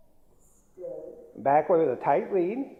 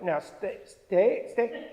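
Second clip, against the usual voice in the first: mock tentative; 3 semitones higher, 5 dB quieter, slower than usual. Trainer imitating hesitant owners commanding a dog, tentative. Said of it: "Don't be tentative".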